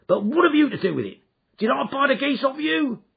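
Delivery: angry shout. Acted. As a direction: bark the pestering man away. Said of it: "he shouted".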